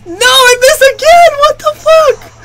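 very high, loud outburst; real gamer rage, pitch shooting right up; enraged shout. Real, not acted. Player raging at another missed attack, enraged shout.

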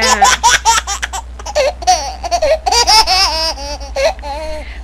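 put-on a baby laughing in mom's lap; baby giggle. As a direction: giggle like a delighted toddler.

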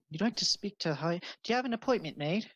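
high, quick, surprised clerk; surprised cleric woman. Acted. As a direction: ask as if it's unheard of.

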